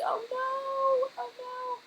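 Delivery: high, fading mock dismay. Real, not acted. Sympathising with a viewer's dried-out lids, high dismay. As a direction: sympathetic little wail that dies away.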